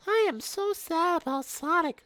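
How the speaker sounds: put-on very high, slow squeaky baby voice; baby Knuckles voice. A narrator's reading. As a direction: squeak like a tiny toddler.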